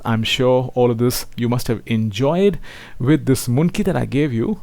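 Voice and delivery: ordinary delivery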